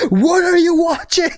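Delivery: high, drawn-out mock yell; slow, high-pitched indignation; outraged dad. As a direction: stretch out a horrified father's demand. Dad confronts woken son.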